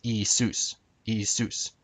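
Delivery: IISUS as ee-ee-SOOS, stress last; modern Greek pronunciation. Shown as a demonstration. Presenter teaching viewers Jesus's name, modern Greek pronunciation. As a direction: say Iisus with long ee, then SOOS. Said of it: "In modern Greek".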